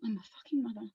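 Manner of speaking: quiet, dazed disbelief; hushed realization. Acted. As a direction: let it sink in almost under the breath. Acted realization sinking in.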